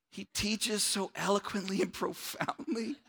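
trying not to laugh. Acted. Preacher quoting praise of his own teaching, barely keeping straight. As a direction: hold back a laugh through the compliment. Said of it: "Can't even say it without laughing".

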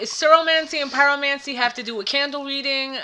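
ordinary delivery

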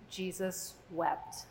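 solemn reading. Shown as a demonstration. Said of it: "reads the verse slowly".

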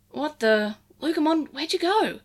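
whisper yelling. Acted. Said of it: "Eiji whisper shouted".

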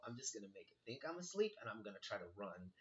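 quick, soft plan to oneself; quiet, hurried inner voice; hushed scheming. Retold or imitated. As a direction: rush it under your breath. Retold secret plan while lying still.

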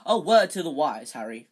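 hearty fatherly advice, falling on the name; paternal. A narrator's reading. Teacher advising Harry, paternal. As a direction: open loudly, then drop confidingly. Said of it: "said Lockhart paternally".

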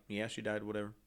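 flat and soft, without caring; indifferent. Retold or imitated. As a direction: shrug it off, as if it doesn't matter to you. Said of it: "he was just like".

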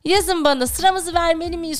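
ordinary talk, nothing marked

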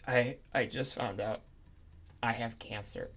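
very soft, sad confession; quiet confession. Acted. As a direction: say it softly, sadly.

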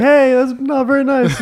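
high, slow mock-hurt voice; hammed-up hurt feelings; offended mountain lion. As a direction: play a wounded lion, loud and high. Playing the mountain lion's reply.

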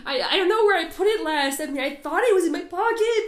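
high, loud, laughing panicked voice; frantic searcher voice. Retold or imitated. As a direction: play someone frantically hunting for a lost thing. Continued mock frantic searcher.

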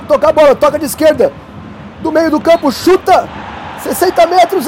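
rising from steady play-by-play to a screaming goal call; explosive goal commentary. Acted. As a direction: build the radio call up and erupt on the goal. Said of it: "eu narro esse gol pra vocês".

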